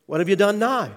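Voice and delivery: a mother's alarmed demand; worried mother. Retold or imitated. Mother voiced in story.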